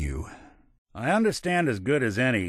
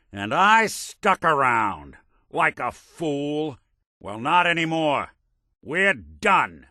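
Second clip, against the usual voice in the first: angry, raised pitch on drawn-out words; fed up; 5 semitones higher, slower than usual. Acted. Partner ending it after sticking around like a fool, angry. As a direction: break it off for good, indignantly.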